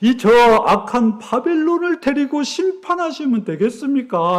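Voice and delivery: imitated prophet's indignant challenge; arguing with God. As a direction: act out righteous anger, taking God to task. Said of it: "또 막 따지는 거예요".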